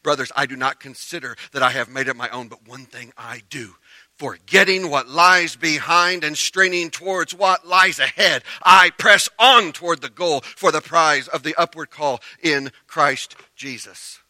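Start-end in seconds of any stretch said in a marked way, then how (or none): 4.2-14.2 loud, slow preaching, pitched up; forceful Scripture reading. Acted. Preacher reading Paul's words to the church, full force. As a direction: press every phrase out loudly.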